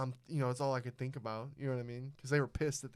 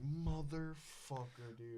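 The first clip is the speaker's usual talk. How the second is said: slow, quieter retold curse; drawn-out dismay; 7 dB quieter, much slower than usual. Kid reacting to being reported for littering, slow dismay. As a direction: stretch the curse right out under your voice.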